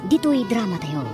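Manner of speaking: normal talking voice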